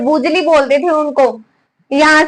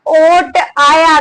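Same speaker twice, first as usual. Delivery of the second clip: slow dictation; much slower than usual. Shown as a demonstration. Dictating for students to write.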